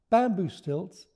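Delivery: plain reading voice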